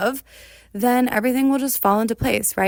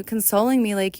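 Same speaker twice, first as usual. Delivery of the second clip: ordinary talk, nothing marked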